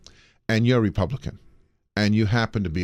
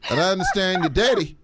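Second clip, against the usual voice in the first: higher, half laughing, a light Trump voice; mock Trump; 5 semitones higher than usual. Playing Trump quizzing the voter about his family, half laughing. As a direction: fake concern with a laugh. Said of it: "now I'm Donald Trump".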